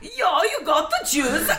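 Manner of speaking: high, drawn-out attempt at a German accent. Shown as a demonstration. Asked to redo the Germans' line, high silly German accent. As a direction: botch a German accent, half laughing. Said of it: "again with the German accent".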